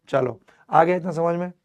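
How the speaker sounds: ordinary talk, nothing marked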